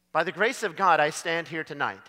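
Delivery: ordinary delivery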